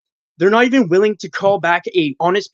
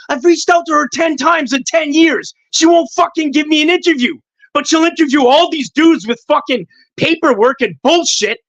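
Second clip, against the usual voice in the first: real outburst, much higher and louder; venting frustration; 9 semitones higher, 4 dB louder than usual. Real, not acted. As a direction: let the pent-up anger burst out. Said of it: "Sorry, I had to let that out".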